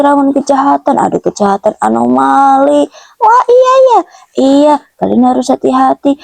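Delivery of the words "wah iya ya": much higher character voice; startled townsperson. Acted. Townsperson answers the warning about anomali, startled. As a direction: pipe up high in alarmed agreement.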